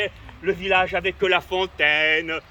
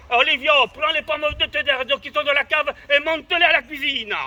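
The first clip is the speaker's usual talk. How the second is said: mother's call, higher and louder; 8 semitones higher, 6 dB louder than usual. Retold or imitated. Imitating his mother ordering him to carry things up. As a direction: shout the chore up the stairs. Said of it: "elle me disait".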